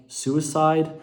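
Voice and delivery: ordinary talk, nothing marked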